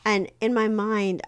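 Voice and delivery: ordinary talk, nothing marked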